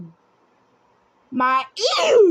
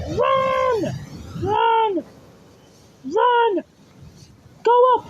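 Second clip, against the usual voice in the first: shouting urgently; 8 semitones higher, much slower than usual. Real, not acted. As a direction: yell at the character to run, fast. Urging himself on in game.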